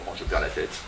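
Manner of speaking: whispered aside with a little laugh; breathless whisper. Acted. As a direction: let it slip out on a whisper. Lover overwhelmed, aside.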